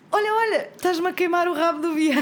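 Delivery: high squeaky quote. Retold or imitated. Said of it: "ele disse a frase".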